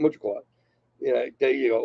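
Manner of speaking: ordinary delivery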